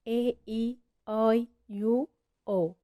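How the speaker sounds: each vowel letter said slowly and clearly; spelled vowel letters. Shown as a demonstration. Teacher spelling out the vowels to a student, slow and clear. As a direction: spell out A, E, I, U, O one by one. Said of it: "احفظها".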